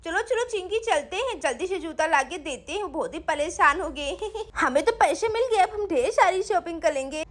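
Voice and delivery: put-on little kid's giggly, eager chatter; excited little girl. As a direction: play an excited child with a giggle. (चहकती छोटी बच्ची की आवाज़)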